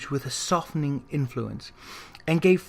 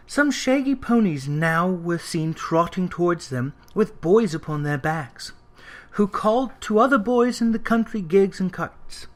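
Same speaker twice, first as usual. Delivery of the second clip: usual speaking voice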